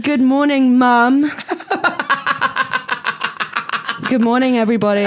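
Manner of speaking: high laughing chant; giggling through the school chant; laughing sing-song. Retold or imitated.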